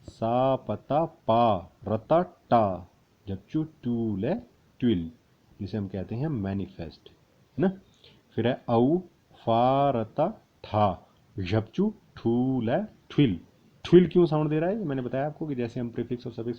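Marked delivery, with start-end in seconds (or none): 0.0-5.1 very slow, syllable by syllable; slow spelling out. Shown as a demonstration. Teacher spelling letters slowly for students. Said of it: "लिखना कैसे". 8.7-13.4 drawn out syllables; slow spelling out. Shown as a demonstration. Teacher spelling letters slowly for students. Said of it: "लिखना कैसे".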